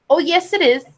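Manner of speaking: joke-teller putting on a hint of Irish brogue; broad Irish accent. Irishman insisting to the interviewer, light mock Irish accent. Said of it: "said the Irishman with a broad Irish accent".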